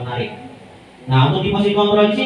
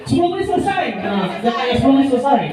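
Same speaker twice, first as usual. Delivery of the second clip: stern shouted order; 6 semitones higher than usual. Shown as a demonstration. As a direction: bark the deadline at the class. Strict teacher ordering class.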